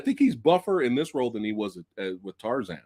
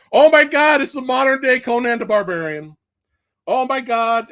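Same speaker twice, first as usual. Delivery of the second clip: much higher and louder, gushing retold excitement; thrilled exclamation; 11 semitones higher, 8 dB louder than usual. Fan reliving his first reaction to the trailer, gushing excitement. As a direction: relive the rush of seeing the trailer.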